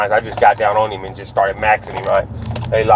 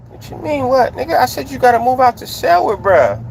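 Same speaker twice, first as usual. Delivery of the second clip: insistent confrontation; 11 semitones higher than usual. Retold or imitated. Acted confrontation, repeats the order.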